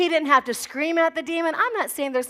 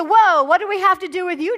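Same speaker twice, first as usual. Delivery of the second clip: higher, louder startled outburst; alarmed demons; 5 semitones higher, 6 dB louder than usual. Acted. Demons startled by Jesus showing up, mock alarm.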